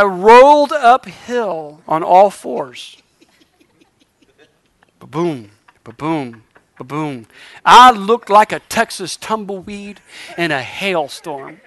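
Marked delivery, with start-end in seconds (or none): 5.0-7.3 deep, soft booming thud imitation; thudding noise. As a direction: mimic the body hitting the floor, low and dull. Imitating his body thudding down.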